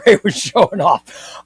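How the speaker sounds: normal talking voice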